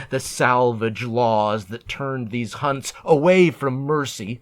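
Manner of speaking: usual speaking voice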